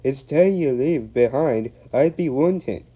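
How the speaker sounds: Scottish burr, loud and high; Scottish engine voice. Acted. Donald scolding the van, loud Scottish. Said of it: "said Donald".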